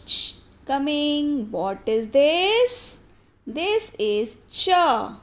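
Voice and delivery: animated storyteller, projected and drawn out; emphatic kids' story voice with stretched key words; children's storytelling. Acted. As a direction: tell it big and clear for little listeners.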